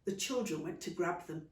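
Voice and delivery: ordinary delivery, nothing marked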